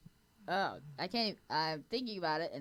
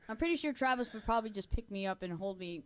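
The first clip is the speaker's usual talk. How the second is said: usual speaking voice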